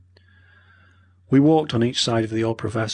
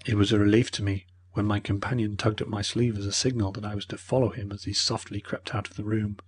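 ordinary delivery

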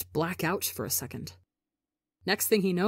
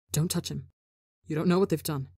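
a mild, understated warning snap; a lightly voiced sharp warning; low snap; 2 semitones lower, faster than usual. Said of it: "a low voice snapping".

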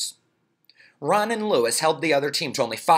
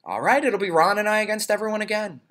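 1 cheerful high Louis voice; 10 semitones higher, 3 dB louder, faster than usual. Acted.

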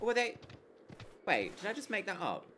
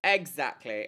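ordinary talk

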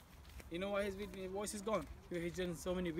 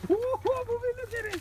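excited; 9 dB louder, slower than usual. Real, not acted. Animals fighting at the fence.

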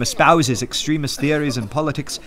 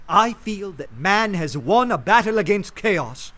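ordinary talk

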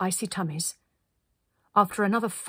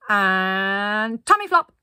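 long held build-up, then a loud command; launch command; 8 dB louder, much slower than usual. A narrator's reading. Linda launching the group onto the ice, calling out. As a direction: stretch the first word, then call it out.

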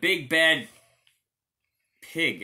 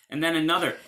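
usual speaking voice

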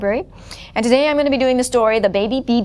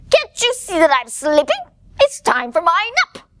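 high little bird complaint; indignant baby bird; 8 semitones higher than usual. Acted. Baby bird trying to nap, indignant.

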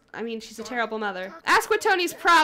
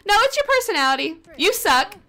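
higher, louder, fast mocking jab; taunting jeer; 7 semitones higher, 5 dB louder than usual. Real, not acted. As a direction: sneer at her, quick and loud. Taunting the game character.

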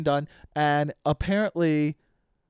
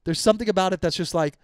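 ordinary delivery

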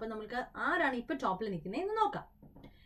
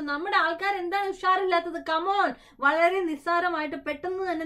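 higher and louder, rallying the players; urging players on; 6 semitones higher, 7 dB louder than usual. Real, not acted. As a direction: pump up the crowd.

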